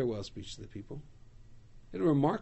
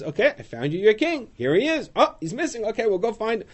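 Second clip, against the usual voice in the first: louder, higher, bustling presenter; bright announcing; 8 semitones higher, 8 dB louder than usual. Acted. Shmuel presenting Saul to the people, louder and higher. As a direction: announce the new king like a showman.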